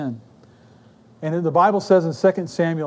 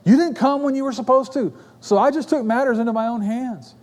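higher-pitched defensive excuse; self-justifying; 6 semitones higher than usual. Retold or imitated. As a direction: push the blame back in a raised pitch. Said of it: "Saul's first reaction is to justify".

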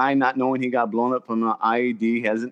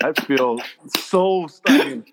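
slow, laughing through the embarrassment; embarrassed laugh; 6 semitones higher than usual. Real, not acted. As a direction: laugh at your own mistake. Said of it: "I'm so humbled at this point".

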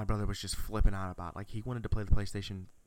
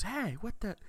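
much higher-pitched mock surprise; imitated startled dad; 13 semitones higher than usual. Dad looks up at brother, imitated startled surprise.

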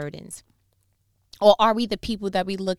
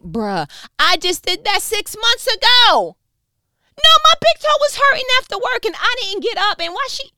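indignant high squeal; 13 dB louder than usual. Pretend. Indignant imagined bystander.

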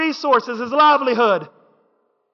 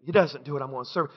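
hushed; 10 semitones lower, 10 dB quieter, much faster than usual. Real, not acted. Preacher drops to a hush.

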